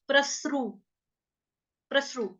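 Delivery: प्रसरु with a half स; pronunciation demo. Shown as a demonstration. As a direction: clip the स short in प्रसरु. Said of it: "लेकिन स हमारा आधा है".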